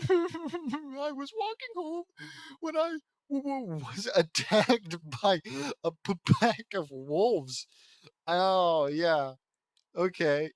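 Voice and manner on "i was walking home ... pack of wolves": high, drawn-out mock sobbing; hammed-up crying. Lana telling her friends about the attack, hammed-up crying. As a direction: wail it slowly, stammering through fake sobs. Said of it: "she was crying hard".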